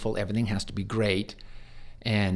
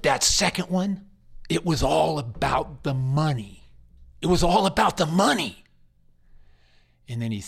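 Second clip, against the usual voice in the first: raised, higher-pitched angry outburst; yelling; 5 semitones higher, 4 dB louder than usual. Retold or imitated. Upset patient's son confronting the chaplain about money, yelling. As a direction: act out an angry man demanding an answer. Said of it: "He yelled at me a little bit more".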